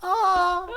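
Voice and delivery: high, far-off falling cry; tiny comic scream of a falling sailor; high mock cry. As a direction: squeal like a tiny sailor going overboard.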